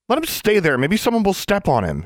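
high squeaky protest; rat squeak. A narrator's reading. Alexandra as a rat protests to Anna, squeaking. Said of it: "she squeaked".

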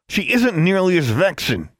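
high-pitched, irritated snap; snapped retort. A narrator's reading. Brother irritated by his sister's challenge, snapping. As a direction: snap back in annoyance. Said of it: "he snapped".

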